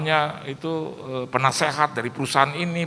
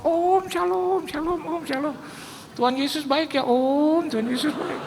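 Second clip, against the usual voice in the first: high put-on pious greeting; mock pious; 12 semitones higher than usual. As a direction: play the suddenly holy guest. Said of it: "orangnya selengean, Pak. Aneh kalau lihat Papa". (pura-pura saleh, suara tinggi)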